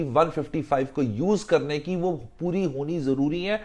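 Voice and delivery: ordinary talk, nothing marked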